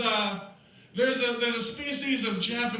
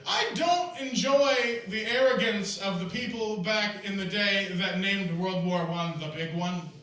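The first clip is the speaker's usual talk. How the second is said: ordinary talk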